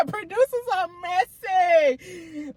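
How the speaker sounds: high, drawn-out words through laughter; real amusement. Real, not acted. Host amused at meddling producers, laughing. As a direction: say it slowly while cracking up.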